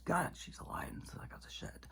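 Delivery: unvoiced exasperated whisper. Real, not acted. Whispering to viewers about Kamala in disbelief. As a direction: hush it like a disgusted aside. Muttered aside.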